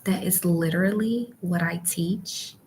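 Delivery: ordinary talk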